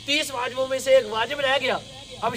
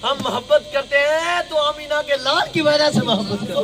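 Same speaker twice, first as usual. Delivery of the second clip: loud, raised-pitch sermon delivery; impassioned preaching; 4 semitones higher, 6 dB louder than usual. Real, not acted. Preacher to congregation on why we love sayyids, impassioned. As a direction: preach it out at full force.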